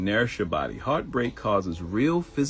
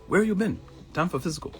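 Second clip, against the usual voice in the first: put-on high lightly accented doctor voice; imitating Chinese doctor; 12 semitones higher than usual. Imitating the doctor greeting a late patient, lightly Chinese accented. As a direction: imitate Dr. Sai, pitched up. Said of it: "he does kinda talk like that".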